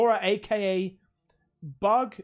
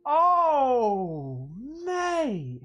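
1 real joyful shout; 4 semitones higher, 6 dB louder, much slower than usual. Real, not acted. Overwhelmed by a gift.

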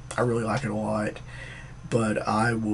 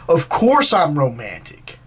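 loud, drawn-out protest, higher then falling; indignant; 4 semitones higher, 8 dB louder, slower than usual. Acted. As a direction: defend yourself with acted offense. Acted boyfriend defends himself.

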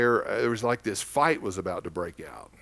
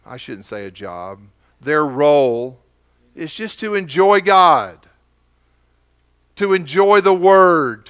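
fervent prayer; 4 semitones higher, 9 dB louder than usual. Acted. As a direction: pray over them with building fervor. Pastor prays over the congregation.

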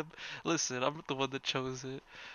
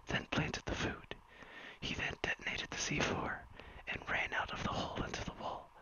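ASMR whisper story. Acted. Streamer whispers a fan story to chat, ASMR hush. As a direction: hush it all like a bedtime ASMR read.

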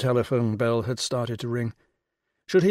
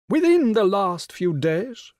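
grand regal lady, pitched high and louder; rich royal voice; 8 semitones higher, 6 dB louder, slower than usual. Acted. The Rani greeting Will on the phone, rich and regal. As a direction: sound like a queen condescending down the phone. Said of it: "the rich royal voice".

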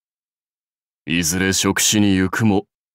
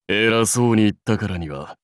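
ordinary talk, nothing marked

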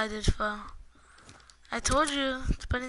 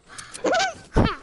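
loud high-pitched cackle; high laugh; 6 dB louder than usual. Real, not acted. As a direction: burst into a squeaky laugh. Laughs at a kill.

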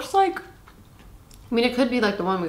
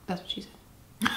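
whispered joke; 9 dB quieter, much slower than usual. Real, not acted.

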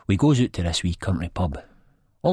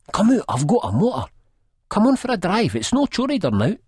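character voice; 5 dB louder than usual. A narrator's reading. Narrator voices Sick Boy's quote.

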